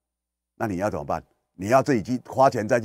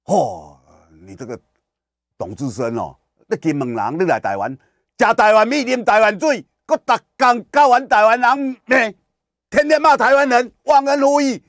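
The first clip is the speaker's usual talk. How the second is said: much higher, louder Taiwanese-flavored tirade; imitating a furious scolding; 11 semitones higher, 8 dB louder than usual. Islander furiously berating the host as ungrateful. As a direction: rant at the host as an ungrateful outsider. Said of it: "所以他可以怎么骂你啊".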